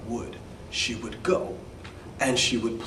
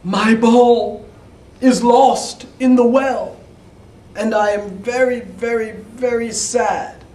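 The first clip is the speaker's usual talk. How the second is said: put-on high, loud, childlike pleading; sad princess; 8 semitones higher, 6 dB louder than usual. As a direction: whine it like a sad little girl. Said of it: "But she said".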